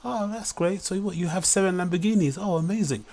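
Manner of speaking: high-pitched, gushing fake interest; feigned amazement. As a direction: humour a tall-tale teller with pretend wonder. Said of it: "kinda grin and pretend".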